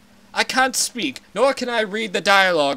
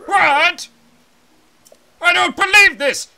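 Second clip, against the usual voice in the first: put-on higher, louder cranky old man; a shouting old advisor, indignant; ranting old Zora elder; 6 semitones higher, 5 dB louder than usual. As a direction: bluster like an outraged elder.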